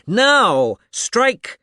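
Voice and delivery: loud, high booming order; thundering command. A narrator's reading. The magician thunders at the old man to strike. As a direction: thunder it like a sorcerer.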